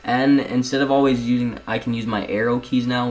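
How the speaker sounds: normal talking voice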